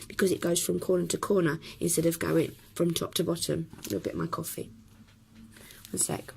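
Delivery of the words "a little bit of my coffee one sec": breathy whisper, away from the mic; whispered aside. Real, not acted. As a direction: drop to a whisper while sipping coffee. Aside while sipping coffee.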